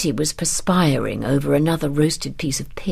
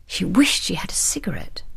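ordinary delivery

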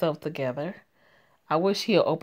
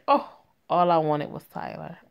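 ordinary delivery, nothing marked

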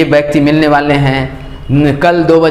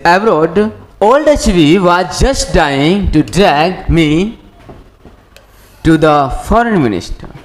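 very slow, word-by-word English reading; measured classroom dictation pace; slow textbook reading; much slower than usual. Acted. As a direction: read out each phrase with long pauses. Reads the English text aloud.

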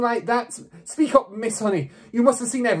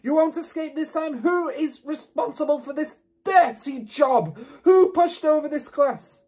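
furious roar. A narrator's reading. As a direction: roar it out like an enraged headmistress. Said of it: "she roared".

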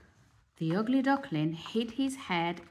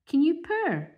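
hissing cat; 10 semitones higher, 7 dB louder than usual. Acted. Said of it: "hissed a cat".

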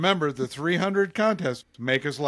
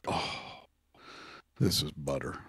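hushed savoring; 11 semitones lower, 9 dB quieter, slower than usual. Retold or imitated. Savoring tobacco, retold.

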